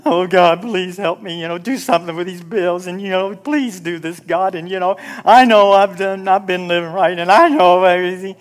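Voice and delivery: whiny, put-on pleading; imitating a pitiful beggar in prayer; mock begging prayer. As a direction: whimper a rambling plea to God, hammed up. Mocking people begging in prayer.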